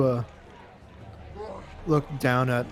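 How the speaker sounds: usual speaking voice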